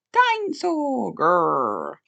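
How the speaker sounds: put-on slow, loud toddler grrr; dinosaur growl. A narrator's reading. George pretending to be a dinosaur, loud growl.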